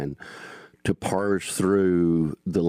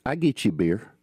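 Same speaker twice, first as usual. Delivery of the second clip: Southern dialect; 3 semitones higher, faster than usual. Shown as a demonstration. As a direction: drop the "will", say it like a Georgian. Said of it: "what it needs to be is".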